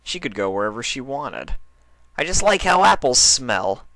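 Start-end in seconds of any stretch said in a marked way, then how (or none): 2.2-3.8 high, louder tomboy pony, defensive; higher defensive Dash. Acted. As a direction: shrug it off as Dash. Dash excuses herself.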